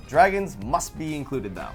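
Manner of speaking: usual speaking voice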